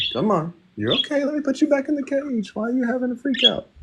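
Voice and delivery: calm the panicking bird in a high voice. Real, not acted. Owner putting frightened parakeet back in cage, high soothing.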